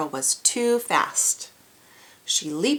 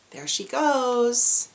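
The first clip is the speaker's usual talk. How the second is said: drawn out; 5 semitones higher, much slower than usual. Real, not acted. Excited aside to kids.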